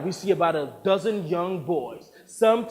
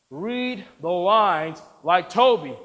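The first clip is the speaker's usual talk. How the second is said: slow, measured command; insistent order; 5 dB louder, slower than usual. Acted. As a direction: spell it out slowly and firmly. Director insists, word by word.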